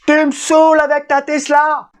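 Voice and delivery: high shouted exasperation; shrill angry wife. Retold or imitated. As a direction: yell it, fed up. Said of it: "je l'imite pas très bien".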